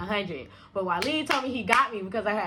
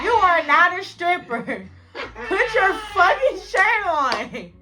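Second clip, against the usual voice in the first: mock scold; 7 semitones higher, 5 dB louder, slower than usual. Scolding friend stripping at club.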